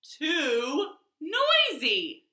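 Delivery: slow, drawn-out fed-up whine; exasperated complaint. Acted. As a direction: stretch it out, fed up. Peter fed up.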